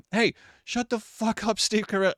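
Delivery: high-pitched mock-indignant outburst; retold rant. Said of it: "I went on a rant".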